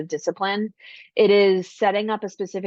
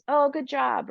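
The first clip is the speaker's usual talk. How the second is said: higher, offhand "Oh, good job" with a falling "Oh"; empty praise; 7 semitones higher than usual. Shown as a demonstration. Parent giving a child quick throwaway praise, offhand. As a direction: toss off a hollow "Oh, good job". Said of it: "not just praise".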